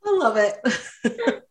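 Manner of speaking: slow, drawn-out words through laughter; real amused laugh breaking through. Real, not acted. Delighted by the couple's imagined phone calls, laughing slowly.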